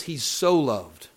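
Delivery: SO LOVED hit loud and drawn out; emphatic SO LOVED. Shown as a demonstration. Preacher stressing a verse to the congregation, emphatic on SO LOVED. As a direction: lean hard on "so loved". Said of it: "puts more emphasis on the word".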